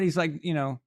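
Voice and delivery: ordinary delivery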